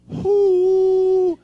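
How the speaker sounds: loud high mock "whoo" instead of blowing; fake blowing noise. Faking the breathalyzer, a loud high whoo. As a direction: pretend to blow, just make a noise.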